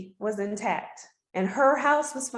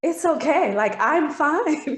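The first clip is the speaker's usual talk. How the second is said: reassuring; 6 semitones higher, 4 dB louder, slower than usual. Retold or imitated. Reassuring crying family members.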